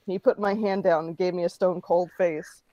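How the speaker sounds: plain reading voice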